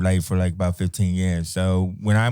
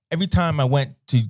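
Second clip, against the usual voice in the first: usual speaking voice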